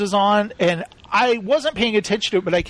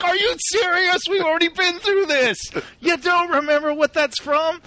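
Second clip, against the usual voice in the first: much higher, incredulous teasing; mock exasperation; 10 semitones higher than usual. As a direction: act appalled he forgot again. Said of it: "And I was like".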